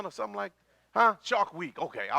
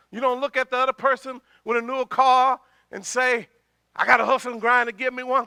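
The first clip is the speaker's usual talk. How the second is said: higher, louder, rolling preacher's run; forceful preaching; 6 semitones higher, 7 dB louder than usual. Real, not acted. Preacher warns congregation against comparing themselves, forceful preaching. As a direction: preach in a quick, pressing stream.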